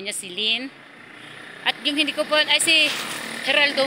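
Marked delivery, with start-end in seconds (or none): 1.6-3.9 excited. Real, not acted. Calling out names excitedly.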